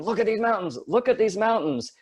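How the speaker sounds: high, amazed calling out; awed exclaiming. Retold or imitated. Cyclist urging German teammates to look, amazed.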